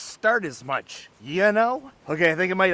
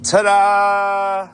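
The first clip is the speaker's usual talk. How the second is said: loud, bright ta-da; ta-da reveal; 4 semitones higher, 7 dB louder than usual. Real, not acted. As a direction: present it proudly. Reveals the couch.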